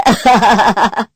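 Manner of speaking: loud, hearty laughter; teasing laugh. Acted. Younger brother teasing newlyweds, loud laughter. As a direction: burst out laughing at your own joke.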